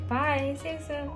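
usual speaking voice

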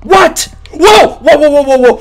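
loud whoas climbing high; alarmed shouting. Real, not acted. Gamer backing off from a game threat, alarmed shouting.

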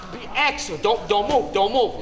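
ordinary delivery

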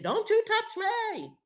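high, loud, drawn-out warning; acted agitated high-pitched yell; worked up. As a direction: shriek it slowly, fending someone off. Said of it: "working herself up".

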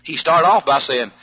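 ordinary delivery

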